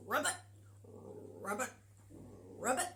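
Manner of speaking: short rising frog croaks; a mock frog croak. Shown as a demonstration. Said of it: "let's all do a croaking frog".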